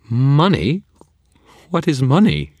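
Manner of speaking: high, loud incredulous fairy; scornful surprise. Acted. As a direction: toss it out in disdain. Fairy scoffs at money.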